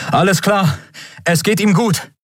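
high, relieved call across the room; calling over. A narrator's reading. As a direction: call out the good news. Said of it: "rief er Endriel und Miko zu".

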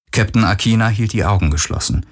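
usual speaking voice

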